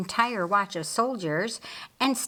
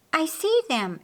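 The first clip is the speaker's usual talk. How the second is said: bright, higher cry of discovery; excited exclamation; 7 semitones higher than usual. A narrator's reading. Queen Esther spotting the soldiers from above, excited. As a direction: blurt it out as you spot them. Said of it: "exclaimed Queen Esther".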